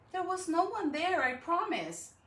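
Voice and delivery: high, pleading promise; protesting child. Acted. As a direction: insist like a caught kid.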